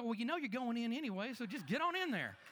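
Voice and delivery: exasperated. Retold or imitated. Pastor fed up with a friend who keeps falling back, exasperated. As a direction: toss it off like you've given up on them.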